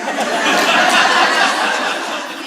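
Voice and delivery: a real, loud, breathy burst of laughter. Real, not acted. Telling the UPS story, bursts out laughing.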